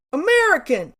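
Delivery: loud, high mock bark; barked demand. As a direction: bark it like a pushy customer. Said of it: "maybe bark".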